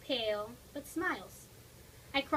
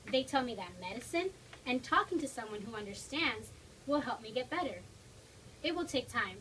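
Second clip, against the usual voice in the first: ordinary delivery, nothing marked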